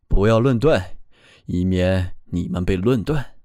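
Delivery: low, solemn voice from above; radiant heavenly choir voice. A narrator's reading. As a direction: pronounce it like a voice from heaven. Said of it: "天空中传来这样的声音".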